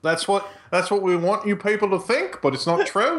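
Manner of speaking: hammed-up Australian accent, mock secret. Shown as a demonstration. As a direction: play the conspiratorial Aussie, half-joking. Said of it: "I'll stop doing that".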